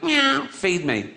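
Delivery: high, slow drawn-out meow; cat voice. Retold or imitated. Early cat to humans, slow high begging meow. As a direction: mew it like a hungry cat begging. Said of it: "and went".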